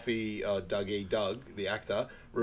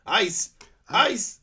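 high, loud disbelieving question, second higher still; incredulous; 10 semitones higher, 6 dB louder than usual. Retold or imitated. Quoting Sanka hearing about the ice, loud incredulous repeat. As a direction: balk at the very word.

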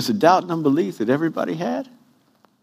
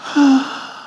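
loud exasperated sigh; heavy sigh; 8 semitones higher, 3 dB louder than usual. Retold or imitated. Sister watching him preach, a big astonished sigh. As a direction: sigh in disbelief. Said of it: "she sits there like this".

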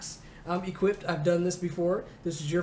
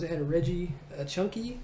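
ordinary delivery

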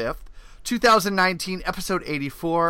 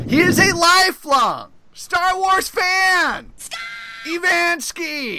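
announcer shout; 12 semitones higher, 3 dB louder, slower than usual. Acted. Hyped host intro.

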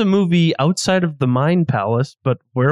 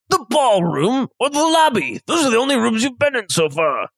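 higher voiced chef, amazed; impressed chef; 5 semitones higher than usual. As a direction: play the chef marveling at the knife work.

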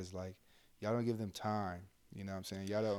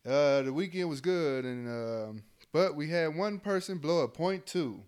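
loud mock announcement to the battalion; imitating the battalion commander addressing the troops, loud; 5 semitones higher, 7 dB louder than usual. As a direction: boom it out like a commander at formation. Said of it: "battalion commander come out on Monday and say".

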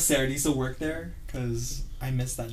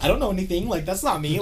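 higher, louder innocent protest; defensive denial; 7 semitones higher, 4 dB louder than usual. Retold or imitated. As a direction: plead innocence a bit too eagerly. Retold denial to sister.

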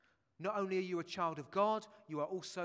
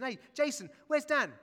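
louder, higher call across the room; mock call out; 9 semitones higher, 10 dB louder than usual. Preacher pretending to ask the father, a loud call. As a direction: call out to Jason as if Dan's missing. Preacher acts out asking Jason.

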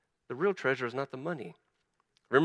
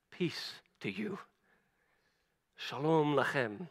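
reassuring steward; 7 dB quieter, slower than usual. Acted. Steward reassuring frightened brothers.

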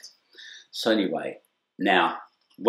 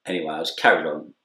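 normal talking voice

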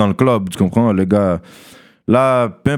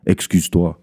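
lower, cold demand of Excuse-toi; a hint of a bossy woman; imitating the woman; 4 semitones lower than usual. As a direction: mimic her haughty order, pitched down. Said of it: "elle me dit comme si".

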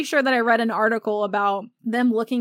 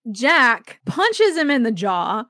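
ordinary talk

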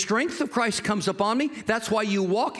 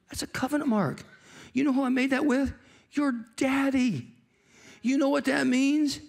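higher-pitched, animated king coaxing a frightened guest; David, reassuring; 7 semitones higher than usual. Acted. King reassuring Mephibosheth over the covenant mark, eager and insistent. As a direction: act an insistent, generous king, building to a promise.

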